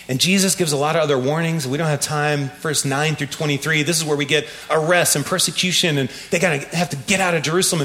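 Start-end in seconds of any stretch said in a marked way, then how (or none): none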